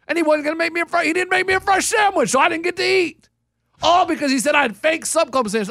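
high-pitched, aggrieved protest; worked-up indignation. Real, not acted. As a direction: get worked up over the unfair treatment. Indignant retelling of being refused food.

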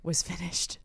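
ordinary delivery

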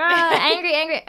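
loud, high cartoon rage; hammed-up tantrum noises in words; mock anger. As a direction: imitate a creature blowing up. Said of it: "his emotions are unhinged".

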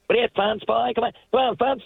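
imitating Fonz. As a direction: poke at him like the kids did, in his accent. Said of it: "we would always kinda imitate his voice".

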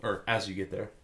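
usual speaking voice

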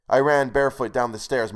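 ordinary delivery

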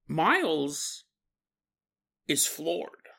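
usual speaking voice

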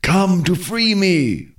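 high, slow, pleading voice of a trapped spirit; pleading cry. A narrator's reading. Trapped Kalunga Ngombe pleading with Ngunza not to shoot, high slow plea. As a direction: beg for your life, stretched out. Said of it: "The voice cried out".

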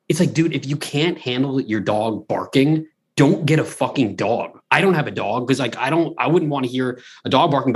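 normal talking voice

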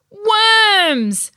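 high, loud, long-held cry; shouted alarm. A narrator's reading. Whole team sees worms in the snack box, shouting. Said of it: "everyone shouted".

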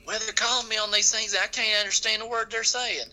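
high-pitched mock complaint of a country customer; complaining customer. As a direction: whine it as a baffled call receiver. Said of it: "How many times have you heard people say".

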